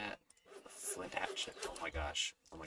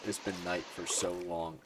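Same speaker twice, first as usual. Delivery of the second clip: ordinary talk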